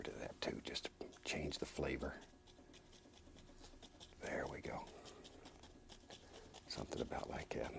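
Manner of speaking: soft unvoiced whisper. Real, not acted. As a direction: murmur it under your breath. Soft asides while painting.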